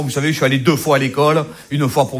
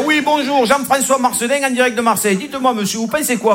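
much higher, chirpy live TV reporter voice; 7 semitones higher than usual. Retold or imitated. TV reporter in Marseille interviewing a local, high and chirpy. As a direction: play the eager news reporter on the spot. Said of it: "ce mec là".

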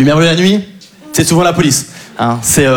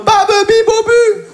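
put-on much higher, babbling baby voice; babbling baby talk; slower than usual. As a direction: babble like a toddler. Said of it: "J'arriverai en mode". (babillage de bébé, voix très aiguë)

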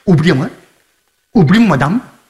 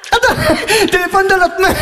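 higher, laughing through the words; 8 semitones higher than usual. Acted. Man laughing at his phone mix-up, higher.

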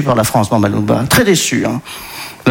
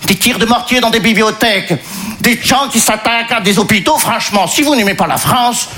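higher-pitched mock Congolese-Belgian French accent; 5 semitones higher than usual. Disappointed migrant complaining to his host, comic Congolese-Belgian accent. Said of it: "Il vient du Congo belge".

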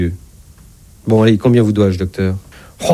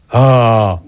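open-throated medical "aah"; patient's "Ah". Acted. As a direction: sustain an "Ah" for the examining doctor. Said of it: "je vous prie de faire A".

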